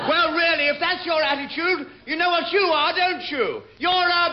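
high, loud huffy outrage; furious and scandalised, voice climbing; indignant shriek. Acted. Officer insulted by bargeman, retorting.

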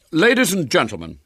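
loud, high, dignified address; great dignity. A narrator's reading. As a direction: address the hall as an injured showman. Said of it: "with great dignity".

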